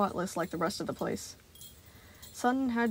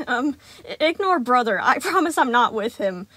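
nervous cheer; 4 semitones higher, 9 dB louder than usual. A narrator's reading. Sun nervously covering for brother.

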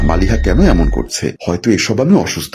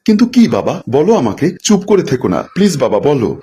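higher, worried begging for an answer; anxious pleading; 5 semitones higher than usual. Acted. Daughter begs her father to go on, anxiously pleading. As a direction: press anxiously for the truth, acted.

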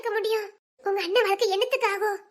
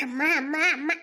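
lower, laughing mock of his mother talk; mocking repetition; 6 semitones lower than usual. Wife mocking husband's constant amma, lower with a laugh. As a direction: tease him by parroting amma.

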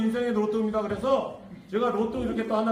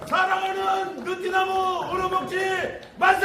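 cheer leading shout; 7 semitones higher than usual. Real, not acted. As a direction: lead the crowd in a cheer. 만세 삼창을 선창함. (만세 선창 외침)

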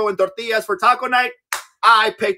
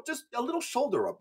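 normal talking voice

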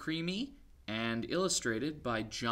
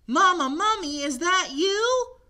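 calling for mama; 15 dB louder than usual. Acted. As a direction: call out lost in the dark. Baby mummy calling.

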